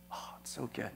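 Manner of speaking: whispered, moved, almost to yourself; awed whisper. Real, not acted. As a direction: breathe it out in a hushed whisper. Preacher savouring Jesus' words.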